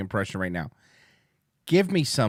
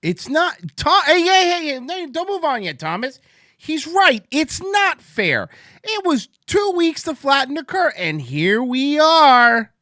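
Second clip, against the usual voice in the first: high, loud indignant ranting; outraged shout; 9 dB louder than usual. Real, not acted. Reacting to Thomas's video, a loud outraged rant. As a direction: shout it like the injustice is personal.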